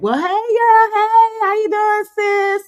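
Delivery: light up like your best friend just walked in; excited greeting. Real, not acted.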